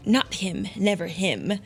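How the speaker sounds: mildly spat-out denial. A narrator's reading. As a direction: spit it out with restrained disdain. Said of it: "The spider spat".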